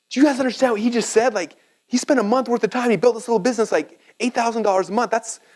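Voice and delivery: high, excited rush, imitating an amazed teacher; hammed-up thrilled professor raving to the class; teacher's excited outburst, pitched high; freaking out excitedly. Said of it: "He's freaking out".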